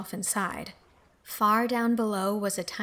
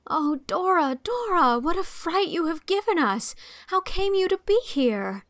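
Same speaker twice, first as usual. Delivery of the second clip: higher, rushed relief at finding her; relieved alarm; 8 semitones higher, 3 dB louder than usual. Acted. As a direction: cry out in fright and relief. Anne finds the lost child.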